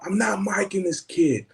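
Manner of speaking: high-pitched ranting New Yorker; mock angry storm-out. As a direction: imitate an indignant crew guy ranting. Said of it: "He storms out".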